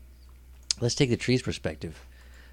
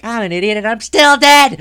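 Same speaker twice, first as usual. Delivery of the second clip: despairing wail; 11 semitones higher, 11 dB louder than usual. Retold or imitated. Climber in free fall sure he is dead, high loud wail. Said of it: "I have this last moment".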